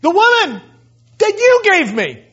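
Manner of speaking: high, loud, whining excuse; whiny blame. Retold or imitated. Adam blaming God for the woman, whiny and loud. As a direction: point the finger like a petulant child. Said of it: "He passes the buck right to God".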